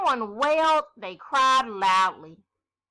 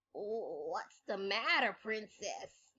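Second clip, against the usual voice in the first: small, much softer, slow voice of a shy frog; timid scratchy frog; 2 semitones lower, 9 dB quieter, much slower than usual. Acted. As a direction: ask timidly from behind her, barely there. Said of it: "a timid, scratchy voice".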